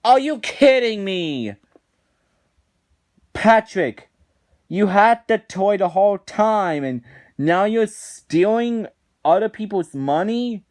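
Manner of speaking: high, loud disbelieving scolding; exasperated rant. Real, not acted. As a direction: scold him in real disbelief. Ranting at Patrick.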